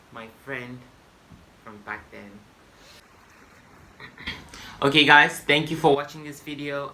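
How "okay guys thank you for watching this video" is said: loud outro. Acted. Vlog outro to viewers.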